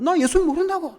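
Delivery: high, loud cursing denial; angry denial. Retold or imitated. Pastor voicing Peter cursing as he denies Jesus, high and loud. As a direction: swear it off angrily.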